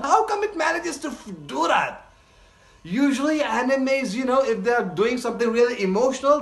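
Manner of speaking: high-pitched, breakneck enthusiasm without extra volume; astonished raving. Real, not acted. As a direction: gush in amazed disbelief. Raving about the episode.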